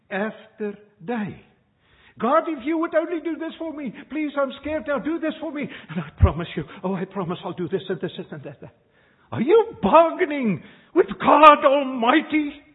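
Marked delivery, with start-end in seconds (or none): 2.2-8.7 pleading fast and much higher, a hammed-up frightened beggar; mock scared bargaining prayer. 9.3-12.6 much louder and higher, indignant challenge; rebuking preacher shout. Real, not acted. Preacher rebukes bargaining with God.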